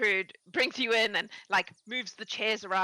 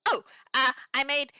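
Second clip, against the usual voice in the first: put-on overexcited old woman; 2 semitones higher than usual. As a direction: squeal with delight at visitors. Gertrude offers ginger cookies.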